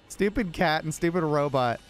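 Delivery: ordinary talk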